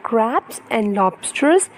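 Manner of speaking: put-on lively, sing-song storybook reading for a toddler; reading to children. Parent reading an ocean picture book to baby, animated storytelling. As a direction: read it brightly to hold a little one's attention.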